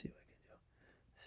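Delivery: breathy whisper, barely there. Real, not acted. Vlogger keeping quiet for the camera, whispering. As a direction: whisper it so nobody in the next room hears. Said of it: "So I gotta be quiet".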